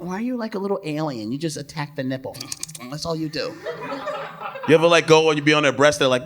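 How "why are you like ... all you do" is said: soft, quick mocking complaint; teasing woman. Woman teasing a guy in bed, soft. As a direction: tease him under your breath. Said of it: "She'll be like".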